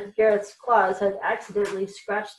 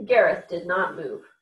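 ordinary delivery, nothing marked

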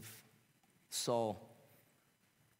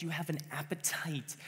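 whispered; 6 semitones higher than usual. Real, not acted. Sudden whisper inside climax.

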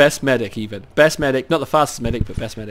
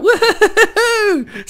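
high, laughing woohoo; joyful whoop; 4 dB louder than usual. Real, not acted. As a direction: celebrate at the top of the voice. Thrilled by a viewer shout-out.

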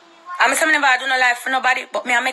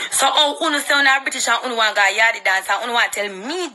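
ordinary talk, nothing marked

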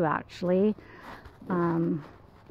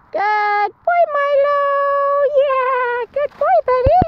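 high, loud gushing praise for the dogs; excited dog praise; 9 dB louder than usual. Real, not acted. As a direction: sound thrilled so the dogs come running. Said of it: "every time I sound excited".

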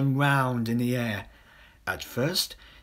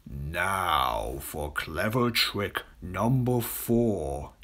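much lower, slow scheming crocodile voice; a deep, slow, sly aside; sly crocodile whisper; 8 semitones lower, 3 dB quieter, much slower than usual. Acted. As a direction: plot the trick slowly and low. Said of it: "he whispered to himself".

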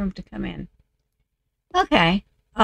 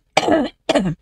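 real cough; 7 semitones higher, 4 dB louder than usual. Real, not acted. Coughing through recording.

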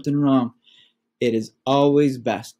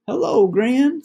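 much higher, bright hello into the phone; Mama sounding sunny for Gran; cheerful phone greeting; 12 semitones higher, 3 dB louder than usual. Acted. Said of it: "said Mama".

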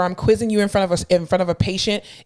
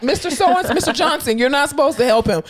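higher-pitched playful telling-off; mock scolding; 5 semitones higher than usual. Clinical instructor to patient helping her student, mock scolding. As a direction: chide a patient in mock protest.

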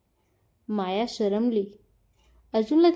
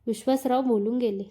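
ordinary delivery

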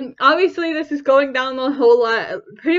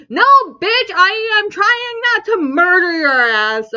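indignant, pitched up and punchy; mock angry retort; 5 semitones higher than usual. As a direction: snap back in mock outrage. Acted angry retort to onlookers.

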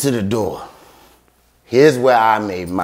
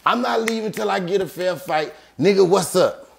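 higher, agitated retold demand for a fight; worked-up demand; 7 semitones higher than usual. James demanding a fair fight from security, worked-up and high.

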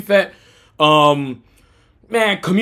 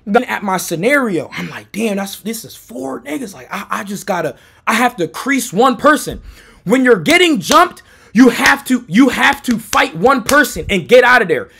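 ordinary delivery

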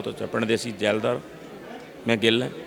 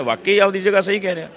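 ordinary talk

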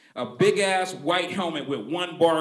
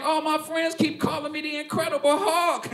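put-on upset kid; 12 semitones higher than usual. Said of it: "I was like".

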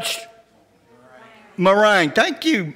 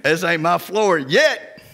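slow punchline; much slower than usual. Acted. Punchline after laugh.

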